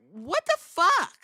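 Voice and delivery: drawn-out disbelieving gasp of words; stunned disbelief. Retold or imitated. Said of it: "I was like".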